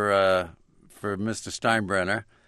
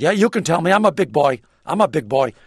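blustery boss; 6 semitones higher, 7 dB louder than usual. Retold or imitated. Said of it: "And he said".

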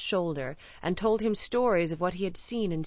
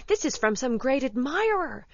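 higher, rising excitement of a student; delighted cry; 7 semitones higher, 4 dB louder than usual. A narrator's reading. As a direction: exclaim with growing joy. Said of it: "he cried".

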